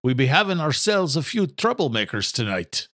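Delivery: put-on old Irish father in a brogue; wry Irish groundskeeper warning his son; lightly voiced Irish brogue; thick Irish brogue. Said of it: "in his thick Irish brogue".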